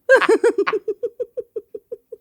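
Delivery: high breathless laughter. Real, not acted. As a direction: dissolve into squeaky laughter. Laughing after the silly song.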